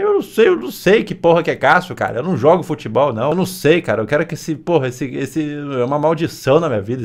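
exasperated rant. Acted. Streamer fed up with Cássio comparisons, loud exasperated rant. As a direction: rant loudly with stumbling repetitions.

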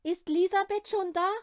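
loud, high, fast anxious asking; worried question. Acted. Nanny anxiously asks the family whether the girl is home. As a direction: ask in a worried rush. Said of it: "fragt sie besorgt".

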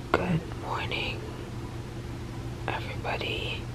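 soft whispered greeting; whispering. Real, not acted. Quiet late whispered greeting.

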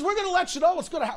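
high, rapid mock announcement; mimicked captain. Captain updating passengers, high hurried patter. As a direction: rattle it off in a high put-on captain voice.

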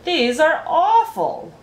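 high, loud, drawn-out complaint; disgust. A narrator's reading. Spelling bee complaining about the food, disgusted. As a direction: protest at a nasty mouthful.

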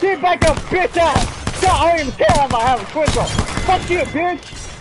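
real high-pitched gamer yelling; loud, excited combat shouting; shouted trash talk. Real, not acted. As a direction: yell taunts at an enemy mid-fight. Trash-talking enemies during game fight.

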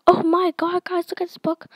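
high-pitched mock gushing; imitated excited reader. Author imitates schoolmates showing off the book, mock-excited. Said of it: "you can't just go like".

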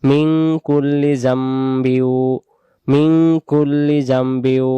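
মিন কুল্লি জামবিউ slowly, ল্লি held double; slow model recitation. Shown as a demonstration. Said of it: "এরপরে আমরা বলি". (মিন কুল্লি জামবিউ ধীরে, স্পষ্ট উচ্চারণে)

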